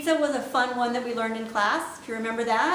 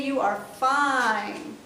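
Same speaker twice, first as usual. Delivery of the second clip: slow, drawn-out fine for a fabulous day; much slower than usual. Shown as a demonstration. Teacher showing a fabulous-day fine. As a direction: linger on fine happily. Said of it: "if you're having a fabulous day".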